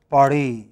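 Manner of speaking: "Paris" said French-style, stress on the last syllable; pa-REE, leaning on the end of "Paris"; French final stress. Shown as a demonstration. Teacher showing how the French pronounce it, final stress on "Paris". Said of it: "the last syllable".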